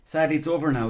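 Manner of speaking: usual speaking voice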